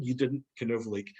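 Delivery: normal talking voice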